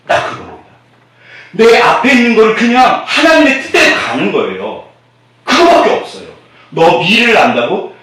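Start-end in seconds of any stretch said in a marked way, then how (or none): 1.5-6.3 preaching shout. Real, not acted. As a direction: call it out to the congregation. Preacher raising voice. (외치듯 설교)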